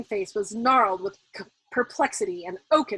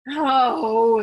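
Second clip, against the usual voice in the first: frustrated moan; 3 semitones higher, 9 dB louder than usual. A narrator's reading. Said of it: "he moaned in frustration".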